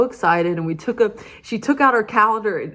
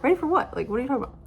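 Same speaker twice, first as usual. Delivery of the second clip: baffled surprise; 5 semitones higher, much faster than usual. Retold or imitated. Retelling to viewers her confusion at friend's words, surprised. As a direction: pitch jumps up on ready for what, then drops away.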